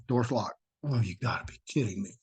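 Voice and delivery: usual speaking voice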